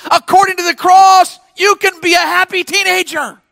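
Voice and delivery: loud, high preaching proclamation; emphatic repeat. Real, not acted. Preacher repeating good news to teenagers, emphatic shout. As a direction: belt it out to the whole room. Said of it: "can I say that again?".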